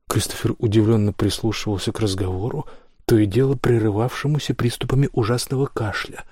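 plain reading voice

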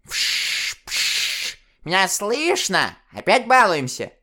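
высокий, скрипучий старушечий, через помехи; сыграй бабку-диспетчера в шипящем динамике; старушечий голос в динамике. Acted. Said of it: "искажённый помехами старушечий голос". (старушка в динамике с помехами)

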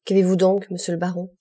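ordinary delivery, nothing marked

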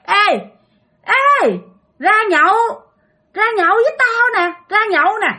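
high, loud, eerie beckoning; ghost calling out. Retold or imitated. Ghost inviting dad out to drink, high insistent call. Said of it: "nghe tiếng kêu mình ra nhậu".

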